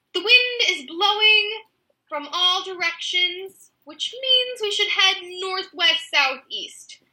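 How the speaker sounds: high, slow and sure of herself; matter-of-fact. A narrator's reading. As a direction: state nonsense as plain fact, slowly. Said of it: "she said matter-of-factly".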